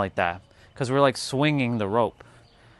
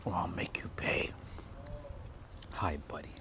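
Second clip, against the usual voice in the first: whisper; 3 semitones lower, 13 dB quieter than usual. Retold or imitated. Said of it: "mock threat".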